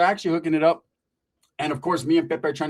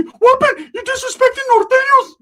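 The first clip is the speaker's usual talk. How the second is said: Ricky's squeaky voice; 9 dB louder than usual. Retold or imitated. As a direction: pitch way up like a squeaky homeboy.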